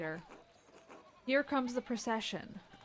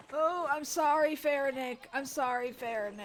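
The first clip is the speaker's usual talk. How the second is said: guilty apology; 3 semitones higher than usual. Real, not acted. Apologizing to the executed character.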